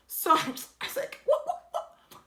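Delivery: breathless squeaky laugh, high up; high giggling what-what-what. Real, not acted. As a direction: laugh out the words in disbelief. Laughing at the absurdity.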